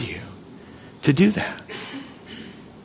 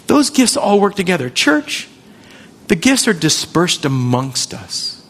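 ordinary talk, nothing marked

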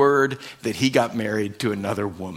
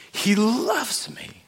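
tearful joy; 7 semitones higher, 3 dB quieter, slower than usual. Retold or imitated. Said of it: "her sobbing just turns to laughter".

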